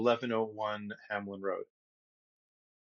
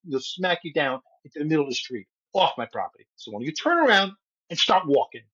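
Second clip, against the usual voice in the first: loud Brooklyn threat, building; Brooklyn tough guy; 5 semitones higher, 7 dB louder, faster than usual. Retold or imitated. Retelling a threat to the neighbor in a loud Brooklyn voice. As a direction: Brooklyn accent, getting louder and meaner. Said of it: "my voice got more and more Brooklyn".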